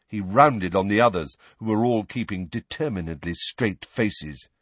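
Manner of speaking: ordinary delivery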